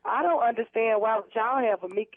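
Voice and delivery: ordinary delivery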